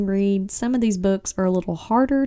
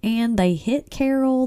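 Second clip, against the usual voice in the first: normal talking voice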